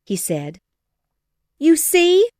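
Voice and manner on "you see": very high, loud outraged cry; indignant cry. A narrator's reading. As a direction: fling it at the room. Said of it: "cried Missus Bennet".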